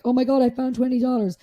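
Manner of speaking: upbeat demonstration of good news; positive tone. Shown as a demonstration. Showing the opposite after finding twenty dollars, upbeat tone. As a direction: say it brightly, as a mild happy exclamation. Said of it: "you can hear the difference in my tone".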